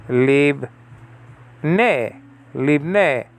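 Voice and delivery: Lib ne split into two clear syllables; Hebrew livne. Shown as a demonstration. Teacher sounding out livne for students. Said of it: "lib, actually".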